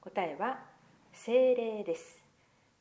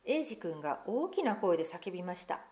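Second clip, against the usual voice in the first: put-on lively picture-book reading to children; story narration; 4 semitones higher than usual. Pastor reading a picture book to children, lively storytelling. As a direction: read the tale aloud to the congregation, expressive.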